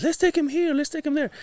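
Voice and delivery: very high, imitated girlish squeal; excited youngest daughter. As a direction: pipe up like an eager kid. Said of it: "the youngest one, she's like".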